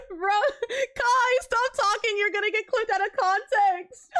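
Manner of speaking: laughing self-reprimand; self-scolding laugh. Real, not acted. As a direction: tell yourself off through giggles. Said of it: "scolding herself, laughing".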